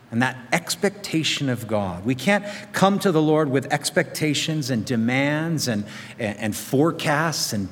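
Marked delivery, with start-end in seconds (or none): none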